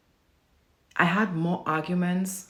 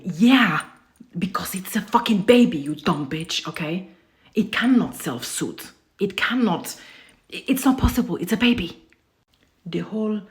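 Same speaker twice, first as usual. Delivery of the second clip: furious rant; 3 semitones higher, 9 dB louder than usual. Real, not acted. Yelling at people who call babies manipulative, furious. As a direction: let it explode at them. Ranting at baby-spoiling advice.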